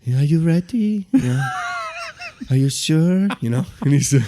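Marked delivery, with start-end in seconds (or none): 0.1-1.1 higher, gentle imitation; put-on soothing question, pitched up; passive, calming voice. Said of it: "with his so passive and calming voice". 2.5-3.3 higher, gentle question; put-on soothing voice, pitched up; passive, calming voice. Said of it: "with his so passive and calming voice".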